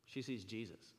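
ordinary talk, nothing marked

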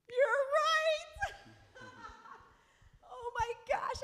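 very high, loud comic wail; exaggerated squealing breakdown; mock bawling. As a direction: wail it in hammed-up tearful realization. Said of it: "I just start bawling".

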